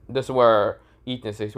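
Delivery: ordinary delivery, nothing marked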